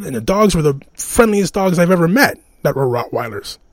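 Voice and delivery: usual speaking voice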